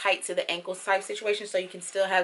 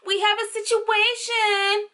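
put-on high, loud kid calling for mom; boy from a commercial; 12 semitones higher, 4 dB louder, much slower than usual. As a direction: imitate a boy calling out, words stretched slowly. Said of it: "that commercial with the boy".

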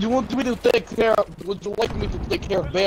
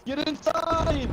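high, long drawn-out cry; urgent warning; 9 semitones higher, much slower than usual. Pretend. Player calling teammates to take cover from bombing, drawn-out alarm. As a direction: stretch it out as a panicked order.

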